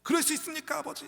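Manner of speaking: indignant questions, climbing high; acted outrage ending in a high cry; angry protest. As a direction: demand an answer from father. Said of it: "막 분노하는 거예요".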